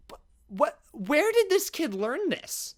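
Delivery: high, slow, halting disbelief; bewildered. Retold or imitated. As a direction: stammer into a high, baffled question. Acting out his bewildered reaction.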